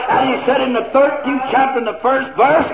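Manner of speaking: loud, high-pitched revival shouting; full-force preaching. Real, not acted.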